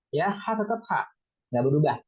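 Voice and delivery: usual speaking voice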